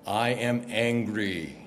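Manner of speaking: very slow, low, ominous pronouncement; deep movie-villain voice from heaven; Darth Vader voice. Acted. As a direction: intone each word deep and slow. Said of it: "I picture something like Darth Vader voice".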